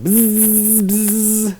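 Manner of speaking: high buzzing bee sound. Pretend. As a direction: buzz like a swarm. Imitating bees buzzing.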